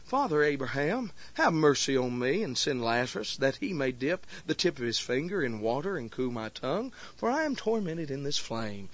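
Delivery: quiet, low, composed prayer voice; the dignified prayer he does not pray; calm formal prayer. Shown as a demonstration. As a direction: recite it politely and dignified. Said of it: "he ain't standing there saying".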